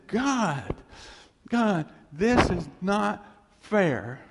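high, loud, slow plea to God; anguished cry. Retold or imitated. Preacher reliving his desert prayer, loud slow anguish. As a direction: drag it out, pleading and raw. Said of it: "I'll never forget that prayer".

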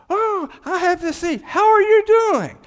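high, loud, bubbly greeting; cheery stranger. Retold or imitated. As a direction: ham up an overly chirpy passenger.

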